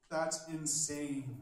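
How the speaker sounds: ordinary talk, nothing marked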